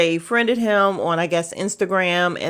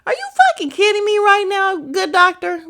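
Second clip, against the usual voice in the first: outraged disbelief; 12 semitones higher, 6 dB louder than usual. Retold or imitated. Retold outraged reaction to question.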